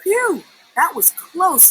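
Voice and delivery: high breathless relief; relieved sputter. A narrator's reading. Verdi escapes the fish, breathless relieved phew. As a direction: puff out the relief after escaping. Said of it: "he sputtered".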